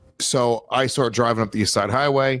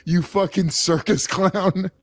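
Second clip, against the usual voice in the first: slow, higher, mock-indignant yell; mock outrage; 6 semitones higher, slower than usual. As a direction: spit it out like an outraged kid. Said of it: "they're just like".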